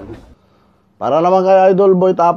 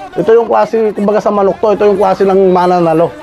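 high, hyped-up explaining; excited commentary; 6 semitones higher, 3 dB louder than usual. Real, not acted. Fight watcher likening Russell to a rooster, excited. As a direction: press the point with raised pitch.

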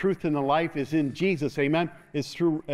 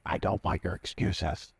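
God's stern voice; 5 semitones lower than usual. Pretend. God telling Jeremiah no excuses, low and firm. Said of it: "God is going like this".

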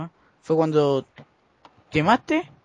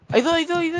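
excited laughing. Real, not acted. Spotting enemies, laughing.

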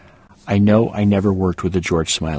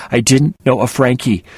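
anxious mantra; 5 semitones higher, 7 dB louder than usual. A narrator's reading. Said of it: "my mantra".

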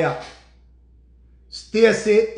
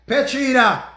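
raised, loud name call; 5 semitones higher, 5 dB louder, slower than usual. Real, not acted. Streamer calling on Petrina to back him up, calling out. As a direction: call her to attention, pitched up.

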